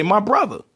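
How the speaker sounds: "brother" said as "bruvva", pitched high; Baltimore pronunciation. Shown as a demonstration. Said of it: "in Baltimore, we say".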